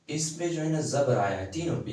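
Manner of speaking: ordinary talk, nothing marked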